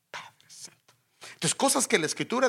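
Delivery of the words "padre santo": soft breathless whisper to God; real whisper to the Holy Father; whispered prayer. Real, not acted.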